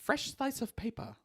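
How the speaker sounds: high, hushed creepy teacher. Retold or imitated. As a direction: lick your lips and say it lewdly. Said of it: "he used to say".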